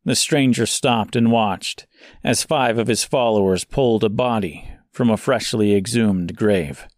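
usual speaking voice

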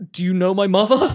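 high, loud frightened thug voice; spooked guard. Acted. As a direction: blurt it out in alarm.

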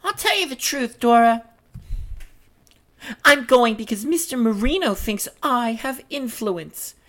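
high-pitched, self-important stage voice; piping character, airily resigned; Marie's character voice. Acted. Reads Marie's part in script.

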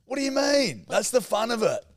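high, loud objection; incredulous protest. Real, not acted. Co-host objecting to preparing accents, loud incredulous protest. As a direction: protest at the top of your pitch.

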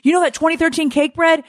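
retold panic. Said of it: "I'm like".

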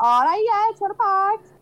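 a touch of broad Scouse patter; Scouse fella. Retold or imitated. Imagining the MP as a local Scouse fella, lightly played-up Scouse. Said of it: "gonna be this Scouse fella".

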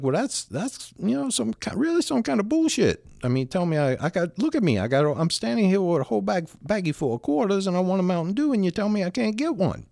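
retelling an argument, worked up; indignant protest. Retold or imitated. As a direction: protest as if you can't believe the refusal. Retold argument with the guard.

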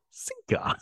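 breathy high-pitched punchline; high squeaky sinker. Retold or imitated. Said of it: "and he's like".

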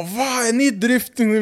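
high, drawn-out mock-amazed question; amazed teen kid. Car kid amazed by strangers' boast, high mock astonishment. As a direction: squeal it like an impressed kid.

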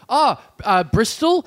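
high, over-familiar questioner; lucid weirdo. Retold or imitated. Weirdo quizzing a stranger on the tram, high over-familiar voice. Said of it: "she names four cities in a row".

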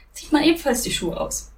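ordinary delivery

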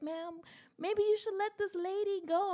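a hint of a timid stranger; lightly voiced other patient. Quoting the waiting woman.

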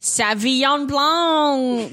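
slow, drawn-out French Sauvignon Blanc; French accent. Shown as a demonstration. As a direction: savour it like a sommelier. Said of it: "one more".

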